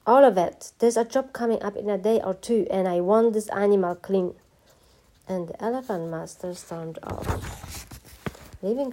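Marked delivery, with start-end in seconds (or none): 0.1-4.3 loud, raised-pitch bossy command; master's loud, cross instructions; angry orders. A narrator's reading. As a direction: order the boy about, starting with an outburst. Angry master gives cleaning orders.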